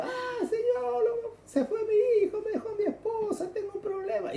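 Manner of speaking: very high, tearful wail, half laughing; imitating a desperate cry for help; mock crying plea. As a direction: whine to God like a frightened believer. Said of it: "a veces estamos así gritando".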